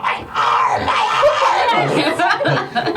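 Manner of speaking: high mock shriek, laughing through; comic pained scream; kobold screaming. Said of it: "this kobold screams out".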